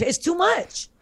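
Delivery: high, worked-up outburst of disbelief; exasperated rant about the award night; heated rant. Real, not acted. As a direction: get heated, voice climbing high. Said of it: "I'm getting heated again".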